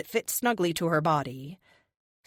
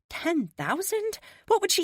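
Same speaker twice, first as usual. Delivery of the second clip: disbelief; 13 semitones higher than usual. A narrator's reading. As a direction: let the amount sink in, stunned. Tia's disbelief at the sum.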